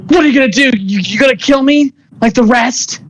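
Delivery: high-pitched acted defiance; defiant challenge. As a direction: challenge the attacker while pinned to the wall. Game master acts pinned woman defying her captor.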